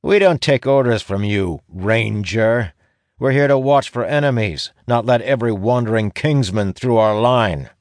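high, defiant guard voice; distrustful guard. A narrator's reading. Stubborn guard refusing a ranger's orders, defiant and high. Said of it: "Vic's eyes narrow".

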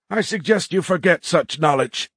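high-pitched, pointed warning from the cadet. A narrator's reading. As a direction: issue a veiled threat in a high voice. Cadet warns enemy surgeon.

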